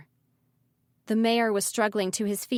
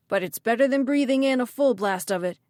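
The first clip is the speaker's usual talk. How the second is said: louder, insistent character voice; urgent young man's voice; 3 semitones higher, 5 dB louder than usual. A narrator's reading. Malachi reasons with Ridley as gas comes, urgent. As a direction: push it out urgently.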